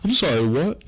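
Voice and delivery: high, loud startled question; mock-shocked reply; alarmed disbelief. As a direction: act out being caught off guard. Acting out alarm at pat down.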